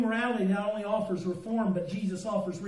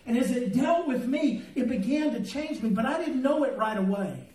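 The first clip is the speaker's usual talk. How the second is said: louder, fervent preaching; 3 semitones higher, 6 dB louder than usual. Acted. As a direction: drive the message home to the church. Preaching rises to full force.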